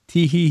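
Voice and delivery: playful teasing tee-hee; mock giggle. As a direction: giggle it like a gossip. Host teasing about recent date.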